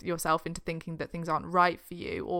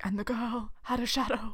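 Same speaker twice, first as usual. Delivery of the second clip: eerie horror narration; 5 dB quieter than usual. Retold or imitated. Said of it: "reminds me of Us".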